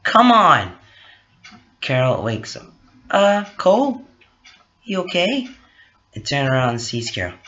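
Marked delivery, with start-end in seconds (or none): 0.0-0.8 loud, high burst of frustration; upset. Acted. Cole is hung up on again, upset. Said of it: "Cole felt upset". 3.1-5.5 higher, lightly voiced Carol; concerned waking girl. Carol wakes up and asks after Cole, concerned.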